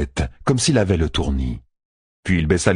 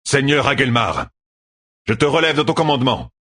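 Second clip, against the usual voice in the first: higher-pitched, decisive pronouncement; firm commanding; 8 semitones higher than usual. A narrator's reading. Commander relieves the general of command, firmly. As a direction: cut in with firm authority.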